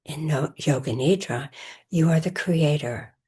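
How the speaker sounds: measured meditation voice with long pauses; guided meditation. Acted. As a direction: guide listeners into rest, phrase by phrase, leaving space between. Leads a recorded Yoga Nidra session.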